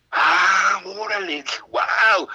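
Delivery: high, loud awestruck wow; amazed exclamation. Retold or imitated. Young visitor learning who the famous voice is, amazed. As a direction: gasp out your astonishment, high and drawn out.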